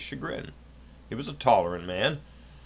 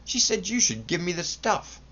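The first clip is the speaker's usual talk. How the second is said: higher, lightly voiced gloating; triumphant; 8 semitones higher than usual. A narrator's reading. Son triumphantly tells father mother agrees, higher. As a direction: play your winning card. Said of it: "said the son triumphantly".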